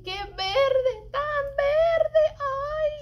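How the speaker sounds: rising sing-song cry of delight; delighted exclaim. Acted. Reading a picture book to children, rising in delight. Exclaiming over the green avocado.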